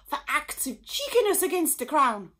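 high, loud royal command; commanding queen. A narrator's reading. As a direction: order it like an outraged monarch. The Queen orders arrest.

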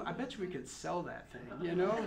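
usual speaking voice

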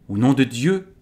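understated royal outburst; exclaiming king. A narrator's reading. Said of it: "s'exclama le roi".

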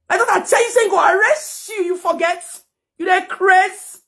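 unload real fury at him; angry outburst. Real, not acted. Yelling at the ex who threatened arrest, furious. Confronting the ex-partner on camera.

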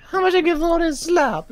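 very high squeaky cartoon clown. Fan imitating a rock and roll clown in a high squeak. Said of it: "he's like".

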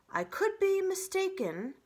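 high, loud storybook owl; hooting owl reply. A narrator's reading. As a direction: lift the words into a high owlish call. Owl answers the crowd.

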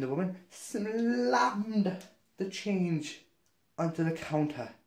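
"slammed" drawn out long and high; dramatic emphasis. Retold or imitated. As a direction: act out the impact, stretching "slammed". Acting out slammed change.

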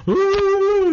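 high wailing sob, imitated; mock sob. Son blubbering on the phone, high mock wail. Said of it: "And I'm going".

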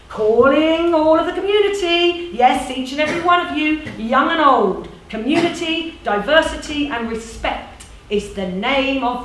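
high, ringing rallying call; emphatic, declamatory organiser; persuasive community organiser. Acted. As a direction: call everyone in like a campaigner. Plays voice calling the community.

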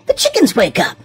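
usual speaking voice

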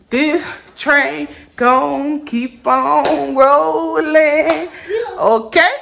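very slow, stretched word by word; drawn-out vow. Real, not acted. Vlogger vowing the show goes on, drawn out. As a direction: drag each word out like a chant.

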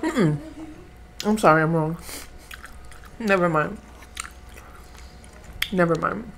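talking between bites; slightly muffled mouth full; chewing food. Real, not acted. Seen 3: Lips closed, chewing around bites throughout; fork lifting food repeatedly.

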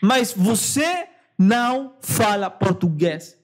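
slow, deliberate mock correction; drawn-out phrase with weighty pauses; slow, emphatic insistence. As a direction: spell it out word by word. Repeats objection slowly for emphasis.

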